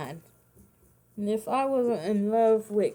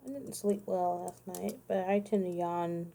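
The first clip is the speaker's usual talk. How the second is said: ordinary delivery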